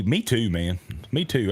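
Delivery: ordinary talk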